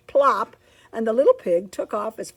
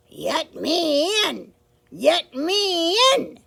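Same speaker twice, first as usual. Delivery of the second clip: wolf demanding; 8 semitones higher, 5 dB louder, slower than usual. Acted.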